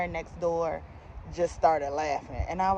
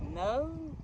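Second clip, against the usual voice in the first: reenacted blunt refusal, drawn higher and softer; flat-out no; 7 semitones higher, 7 dB quieter than usual. Fitter refuses customer's proposition, a flat-out no. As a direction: turn her down with one lifted no. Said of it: "I flat out said it just like that".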